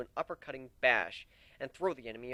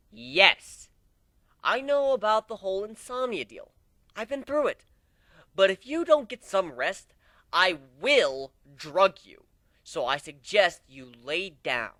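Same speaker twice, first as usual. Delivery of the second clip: stern mentor; 5 semitones higher, 7 dB louder than usual. A narrator's reading.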